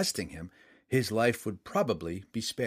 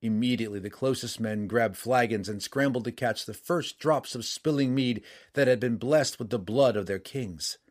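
plain reading voice

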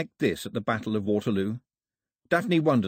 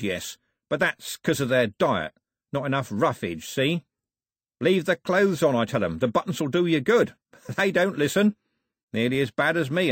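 higher, showy rogue making introductions; mock-courteous villain; 5 semitones higher, 3 dB louder than usual. Cox introduces his villainous lads to Daphne, mock-courteous. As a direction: play the gracious host with oily relish.

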